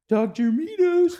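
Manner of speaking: very high, loud squeaky puppet voice, slowed; Snooki voice. Acted. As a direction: squeal it like a cartoon sidekick. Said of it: "Very good".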